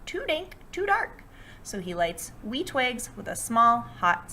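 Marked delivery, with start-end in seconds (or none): 0.0-1.2 high, squeaky little mouse. Acted. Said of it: "Mouse squeaks".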